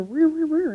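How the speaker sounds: siren wail mimicked by voice; high, wavering mock siren; imitated police siren. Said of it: "and I hear".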